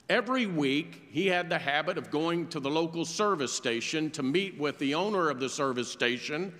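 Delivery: ordinary talk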